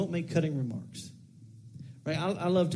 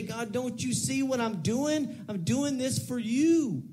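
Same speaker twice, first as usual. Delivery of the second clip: demanding attitude; 8 semitones higher than usual. Acted. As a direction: insist you are owed, stretching the words. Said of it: "but with that attitude".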